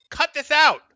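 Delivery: loud, high burst of exasperation; exasperated scolding. Real, not acted. Coach watching a replay tells the player off, exasperated.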